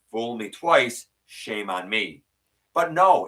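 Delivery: usual speaking voice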